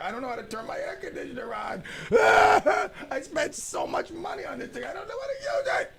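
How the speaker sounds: high, wailing fake cry with laughs; hammed-up crybaby wail; mock whining. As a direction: wail about the AC like a baby. Whining about air conditioner.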